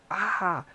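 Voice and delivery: high short yelp of pain; a quick hurt cry. Retold or imitated. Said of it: "he go".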